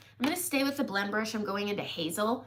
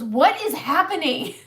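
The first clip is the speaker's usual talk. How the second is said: loud, higher, bewildered cry; louder, imitating a baffled husband; husband's startled question; 5 semitones higher, 9 dB louder than usual. Imitating husband watching his sister fall, loud bewildered cry. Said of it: "my husband just goes".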